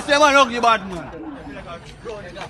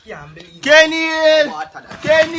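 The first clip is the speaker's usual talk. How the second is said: shouting; 7 semitones higher, 4 dB louder than usual. Acted. Shouting Kenny's name in disbelief.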